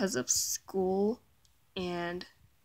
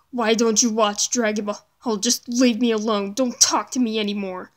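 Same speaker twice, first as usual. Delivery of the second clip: loud mocking yell; 8 dB louder, faster than usual. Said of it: "he was saying things like".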